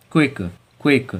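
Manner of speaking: क्विक said crisply as "kwik". Shown as a demonstration. Teacher drills क्विक for the class. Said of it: "आई सी के".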